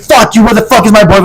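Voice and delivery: high-pitched mock outburst; imitated angry screaming. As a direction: mimic an angry boyfriend demanding answers. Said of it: "Like, screaming at me".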